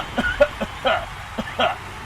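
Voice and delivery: real coughing attack; coughing fit. Real, not acted. Host breaks into a real coughing fit on air. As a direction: cough hard, out of control. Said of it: "kind of a coughing attack".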